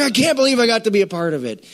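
high, bubbly disbelief; an overjoyed cashier; excited cashier. Retold or imitated. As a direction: gush it like she can't contain it. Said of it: "she was just so excited".

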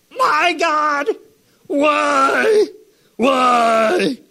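high, crying wail of why; mock anguished cry. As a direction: ham up a despairing plea to God.

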